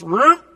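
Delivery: quick, high imitated swoop; swerve noise. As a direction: mimic a car veering off, loud and sudden. Imitates car swerving away.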